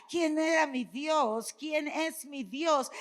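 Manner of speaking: high, loud declaring, slowing at the end; triumphant sermon proclamation; loud declaration. Real, not acted. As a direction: proclaim it, then slow right down. Preacher declaring God's identity.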